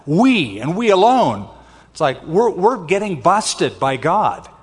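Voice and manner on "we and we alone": both WEs hit hard with big swoops in pitch; emphatic. Shown as a demonstration. Pastor shows how the Hebrew stresses "we", emphatic. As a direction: lean heavily on each "We" and on "alone". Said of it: "It's emphatic".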